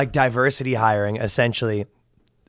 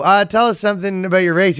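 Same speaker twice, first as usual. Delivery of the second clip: mock quote; 7 semitones higher than usual. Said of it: "And you go".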